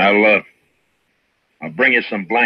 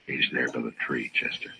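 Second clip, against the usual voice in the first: much softer, low-voiced; hushed; 9 semitones lower, 11 dB quieter than usual. Marshal tells Chester where the gunman hides, very softly. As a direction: keep it down so he won't hear.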